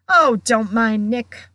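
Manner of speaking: loud, jovial dismissal; shouted. A narrator's reading. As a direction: call it out breezily over the crowd. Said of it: "shouted Sir Patrick's head from the floor".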